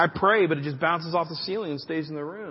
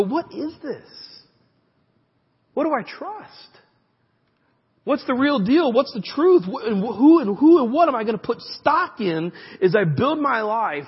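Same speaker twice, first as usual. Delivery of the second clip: pitched higher, urgent rising questions; pleading questions; 5 semitones higher, 6 dB louder than usual. Retold or imitated. Preacher voices a confused believer asking what to trust, high and urgent. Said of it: "you ask and you go".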